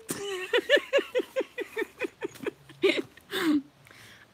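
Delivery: real high cackling laugh; high laughter. Real, not acted. Telling viewers about the checkout, high loud laugh.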